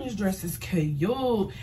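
normal talking voice

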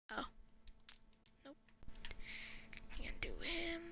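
breathy whispered aside. Real, not acted. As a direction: whisper it under your breath. Whispered aside during game.

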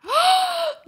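sharp high gasp of dread; short high startled gasp. Real, not acted. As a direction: gasp as the news lands. Waiting for the reaper's verdict.

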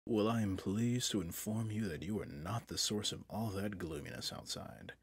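ordinary talk, nothing marked

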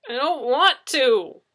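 sulking AI refuses its job, loud and slow; retort. A narrator's reading. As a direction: throw it back defiantly. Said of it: "they retorted".

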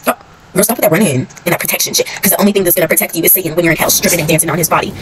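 rapid, loud trash talk; fast loud rant. Acted. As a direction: fire off the insults fast.

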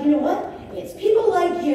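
high, loud, indignant rant; retold telling-off. Woman ripping into her husband's client, indignant. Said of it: "ripped into that guy".

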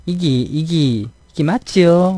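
slow, drawn-out Gyeongsang auntie question; lightly voiced dialect-heavy gym auntie. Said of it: "사투리 좀 심한 아줌마". (느리게 늘이는 경상도 아줌마 사투리)

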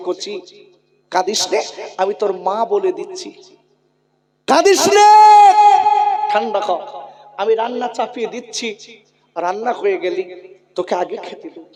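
mother's comfort. Acted. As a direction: soothe the crying child gently. Mother comforts crying children. (কাঁদা সন্তানকে নরম গলায় সান্ত্বনা দেওয়া মা)

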